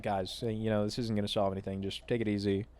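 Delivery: soft, hushed plea to take it easy; quietly settling everyone; hushed peacemaker trying to calm the fight; calming them down. Retold or imitated.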